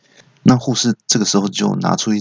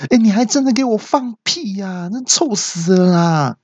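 high-pitched mock yelling, stretched at the end; imitated outraged cry; 10 semitones higher than usual. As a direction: squawk in disgust like the nurse. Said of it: "听到护士大叫说". (高八度地学护士大叫)

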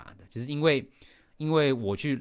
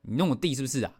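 normal talking voice

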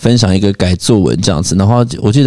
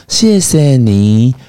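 slow mother's voice; much slower than usual. Retold or imitated.

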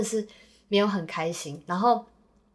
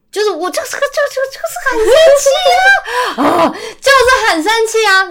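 high, loud angry shout breaking into laughter; furious shout turning to a laugh; angry outburst; 14 semitones higher, 10 dB louder than usual. Real, not acted. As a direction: vent the fury, half laughing.

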